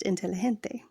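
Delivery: inteligente with the g breathed like an h, in-te-li-HEN-te; inteligente, g said as a soft h; Spanish g as h. Shown as a demonstration. Teacher showing the Spanish g: inteligente with an h sound. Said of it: "The letter G is pronounced H".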